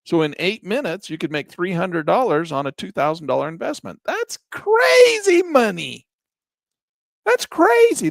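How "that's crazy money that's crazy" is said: high, loud, drawn-out amazed shout; excited exclamation. Real, not acted. Teacher to viewers about fast profits, amazed high shout. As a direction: stretch it out in sheer disbelief at the money.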